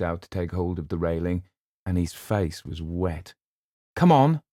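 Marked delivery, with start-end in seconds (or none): 3.9-4.4 higher, louder, curt command; sharp. Acted. Luke curtly orders Eleanor off the platform, sharp. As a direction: snap it out impatiently. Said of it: "he said sharply".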